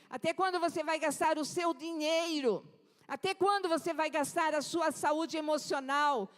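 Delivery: rising fervent preacher's cry; preaching. Real, not acted. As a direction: drive the question home at the congregation. Preacher building intensity.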